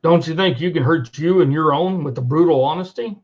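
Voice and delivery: usual speaking voice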